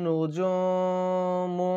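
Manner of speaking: নুজুমু with a long nasal hum before the জ; slow, nasalised নুজুমু held out; nasal ghunna on noon. Shown as a demonstration. Teacher drawing out নুজুমু for students to copy. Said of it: "এখানে কেন আমরা মুং বললাম".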